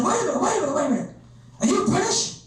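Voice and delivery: high-pitched mock American biker; puzzled biker. Biker to the man he grabbed, as a mock American tough guy. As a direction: put on a biker suddenly struck by curiosity. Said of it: "he said".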